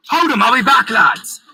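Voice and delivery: loud parting shout; yelled. A narrator's reading. Hero to his mates while running off, yelling. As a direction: yell back while taking off. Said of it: "I yelled".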